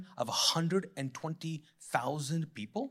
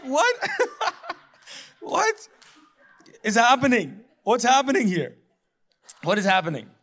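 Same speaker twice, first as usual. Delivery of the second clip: high, loud, laughing "what is happening"; stunned and cracking up at the absurdity; laughing disbelief; 6 semitones higher, 7 dB louder than usual. Retold or imitated.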